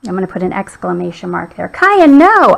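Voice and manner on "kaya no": high, falling mock yell; mild yell. A narrator's reading. Owner to dog jumping at a frog, mild yell. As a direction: call the dog off, higher but not shouted. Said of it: "I yelled".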